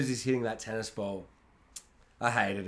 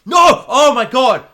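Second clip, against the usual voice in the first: much higher, louder delighted cry; excited outburst; 11 semitones higher, 15 dB louder, slower than usual. Real, not acted. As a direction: burst out in delight. Excited reaction to film title.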